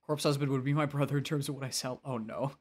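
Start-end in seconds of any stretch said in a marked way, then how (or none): none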